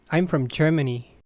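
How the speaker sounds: normal talking voice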